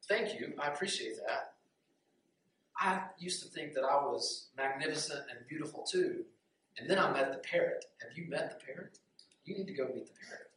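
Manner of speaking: soft, understated reply; lightly voiced humble swan, hushed; modest swan. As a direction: answer the compliment quietly and modestly. Swan modestly answers the crow.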